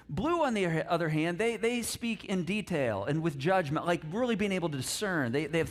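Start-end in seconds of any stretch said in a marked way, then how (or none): none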